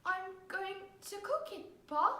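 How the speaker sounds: put-on high, soft, hesitant child; timid little boy. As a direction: answer meekly after being shouted at. Frightened boy answers shouting father.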